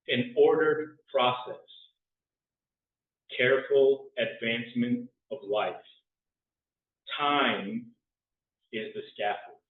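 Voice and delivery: slow, spare haiku reading; hushed sparse haiku. Acted. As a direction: let each haiku line hang. Reads a haiku.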